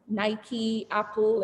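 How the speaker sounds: ordinary delivery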